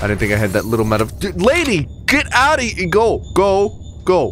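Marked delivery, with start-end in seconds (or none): 1.2-4.3 high, loud shouting go; shooing away. Real, not acted. Gamer shouting at an enemy to go. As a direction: yell at the enemy to leave.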